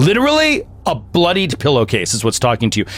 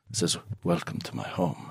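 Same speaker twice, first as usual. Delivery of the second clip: soft, low greeting from a dead man; hushed, eerie welcome to guests; quiet, ominous host's murmur; undead host; 5 semitones lower, 15 dB quieter than usual. Acted. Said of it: "the male figure ... and says".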